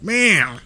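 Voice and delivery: high, loud cat meow. Retold or imitated. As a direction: mimic a cat popping out. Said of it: "came out of there like".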